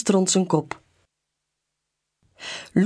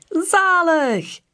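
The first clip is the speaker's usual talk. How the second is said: cheering; 11 semitones higher than usual. A narrator's reading. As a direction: shout for joy mid-slide. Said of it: "juicht hij".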